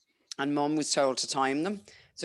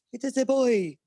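put-on high, loud French accent; 10 semitones higher, 6 dB louder than usual. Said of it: "his French accent".

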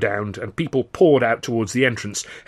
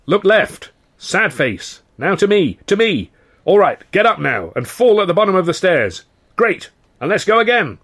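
high, raised director's shout; shouting instructions; 8 semitones higher, 4 dB louder than usual. A narrator's reading. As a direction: bellow directions over a constant racket. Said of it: "director was shouting his instructions".

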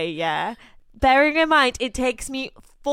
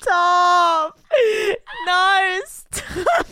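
laughing protest; 9 semitones higher, 3 dB louder, much slower than usual. Real, not acted. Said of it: "laughing protest".

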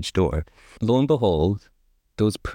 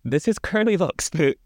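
a touch of horror, pitched up; mild shocked cry over a found foot; cried in horror; 8 semitones higher than usual. A narrator's reading. Father finds a foot in his soup, mildly horrified cry. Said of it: "he cried in horror".

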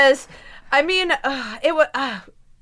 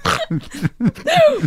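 high snorting laugh burst; a big snort of laughter; 14 semitones higher than usual. Real, not acted. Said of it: "There's the snort".

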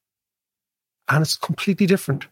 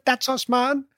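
lightly imitated Jamaican accent, higher; 9 semitones higher, 4 dB quieter, slower than usual. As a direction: retell it in a hint of Jamaican accent. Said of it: "talking about the accent".

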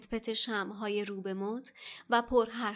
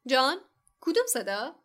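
confused; 3 semitones higher, 7 dB louder than usual. A narrator's reading.

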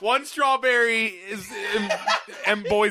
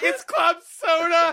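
mocking retort; 7 semitones higher, slower than usual. Host mocking the fake champagne, high and scoffing. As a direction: scoff at the prop drink.

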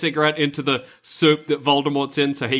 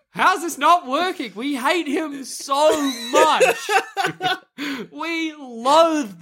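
high, slow, laughing through the words; 10 semitones higher, slower than usual. Real, not acted. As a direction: crack up while speaking.

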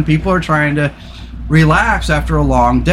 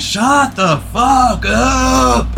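retold yell; 6 semitones higher, much slower than usual. Yelling out the kitchen window at noisy neighbours, slow mock shout. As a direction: drag it out like snapping at the neighbours. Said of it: "I went".

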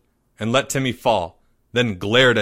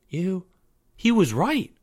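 put-on high, halting kid voice; slow down on the horrified accusation; shocked; 7 semitones higher than usual. A narrator's reading. Boy realising the girl is evil, high and shocked. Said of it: "said Timmy, shocked".

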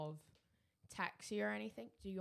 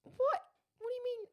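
indignant shock; 7 dB louder than usual. Real, not acted. Indignant at insult.

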